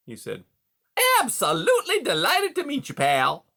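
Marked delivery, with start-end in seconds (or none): 1.2-3.5 high, loud, beaming delight; enthusing beaver. A narrator's reading. Beaver pretending delight to meet prisoner Rye, high and grinning. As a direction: gush like a smiling con man. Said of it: "enthused with a big grin".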